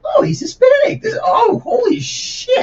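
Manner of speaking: high, loud, slowed disbelieving cry; mock shocked shout. Hammed-up shock on hearing the new chef talk. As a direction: shriek it in fake disbelief. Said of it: "I was like".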